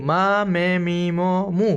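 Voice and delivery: মা, মে, মি, ম, মু said one by one, each clear; syllable drill. Shown as a demonstration. Teacher sums up syllables for learners, clear drill. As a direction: recap মা মে মি ম মু as a model list. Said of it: "সবগুলার উচ্চারণ কিন্তু সেমই".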